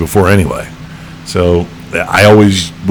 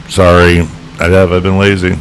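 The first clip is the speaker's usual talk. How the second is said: usual speaking voice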